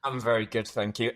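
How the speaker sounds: normal talking voice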